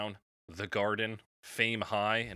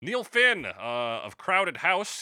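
excited announcement; 9 semitones higher, 9 dB louder, much slower than usual. Acted. Hyping up the next artist.

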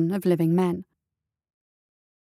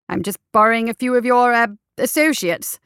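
rushed start, then stumbling on the word; fumbling; 4 semitones higher, 4 dB louder than usual. A narrator's reading. Doctor makes excuses to the guardsmen, fumbling. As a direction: improvise an excuse, groping for words. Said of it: "the doctor fumbled".

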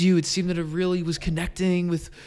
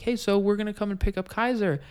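retold chirpy caller; 6 semitones higher, 3 dB quieter than usual. Retold puppy buyers phoning, chirpy.

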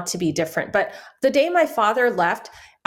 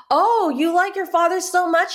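high, mocking sneer as the furious mother; imitating a livid mother's sarcastic jab; a hint of the furious mother taunting her son; 9 semitones higher than usual.

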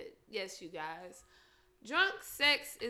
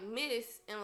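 ordinary talk

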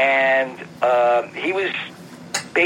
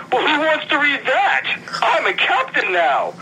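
indignant Takei; 12 semitones higher than usual. Retold or imitated. Takei's retort, retold.

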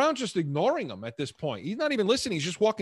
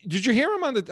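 normal talking voice

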